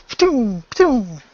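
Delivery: high, punchy ptoom laser sounds, falling at the end; mock laser blasts. Player making laser noises as his character fires, high and punchy. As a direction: spit out each shot like a sci-fi blaster.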